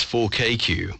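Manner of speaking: ordinary talk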